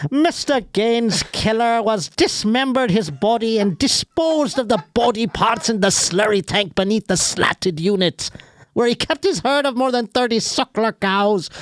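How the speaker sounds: high-pitched mock Irish brogue; put-on Irish accent. Shown as a demonstration. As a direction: read it in an exaggerated, high Irish lilt. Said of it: "I can do it when they write like them".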